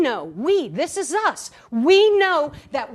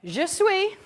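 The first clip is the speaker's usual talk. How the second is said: French apology; 4 semitones higher than usual. Shown as a demonstration. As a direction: start a heartfelt French apology. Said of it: "But French is different".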